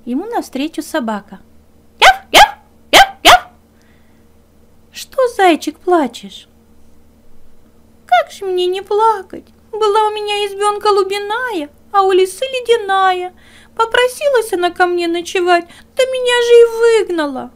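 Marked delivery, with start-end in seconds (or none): 2.0-3.5 yappy dog barks, high and loud; high loud barking. Acted. Dog barking at the crying hare, high yaps. 4.9-6.5 higher, louder dog asking; dog's voice. Acted. Dog asks the crying hare, higher and louder. 8.1-17.5 high, louder tearful little hare voice; weeping hare. Acted. Hare complains to the dog, high and tearful. Hare tells his woes.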